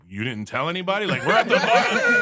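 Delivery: rising to a high, loud protest; mock indignant. Customer to robbed bartender, building mock indignation. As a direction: build from disbelief to laughing outrage.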